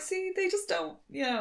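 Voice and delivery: high, soft crestfallen voice; lightly voiced sad hillbilly; mock dejected Dale. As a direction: sulk it as the rejected Dale. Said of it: "he comes back and he's like".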